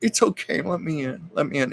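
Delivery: high-pitched coaxing, acting it out; coaxing plea. Daughter coaxing at the door to be let in, pleading. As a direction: imitate someone coaxing to be let in.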